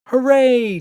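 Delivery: high, loud cheer; a child's delighted hooray. A narrator's reading. Emma cheers Daddy's plan, high and loud. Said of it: "cheered Emma".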